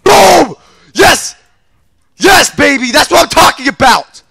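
loud, high celebration; triumphant shout. Real, not acted. Gamer celebrating a kill, triumphant shout. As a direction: whoop it up at full voice.

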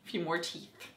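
low, soft, offhand retelling; a hint of a casual villain. Captor boasting about pulling teeth, understated soft menace. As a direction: toss it off quietly, lightly menacing. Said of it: "he's like".

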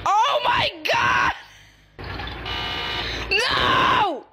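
hammed-up outraged shouting; Shrimpo. Angry shrimp in the game, exasperated mock-furious shout. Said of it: "play as this little angry shrimp".

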